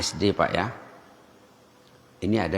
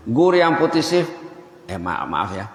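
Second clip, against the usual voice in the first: ordinary talk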